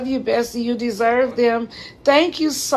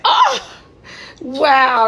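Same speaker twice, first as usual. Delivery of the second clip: surprised; 4 semitones higher, 4 dB louder than usual. Real, not acted. Reacts to a comment, delighted.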